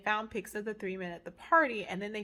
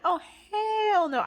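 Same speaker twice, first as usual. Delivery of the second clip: high, drawn-out mock alarm; emphatic retold refusal; 10 semitones higher, 4 dB louder, much slower than usual. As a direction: stretch it out high in exaggerated refusal. Retells own shocked refusal.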